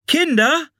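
high searching call; woman calling out. A narrator's reading. A woman searches for the children, high call. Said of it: "rief eine Frau".

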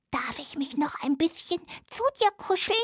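high little mouse voice; little hazel dormouse. Acted. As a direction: play a tiny friendly mouse, pitched high. Hazel dormouse asks softly. (hohe Stimme einer kleinen Haselmaus)

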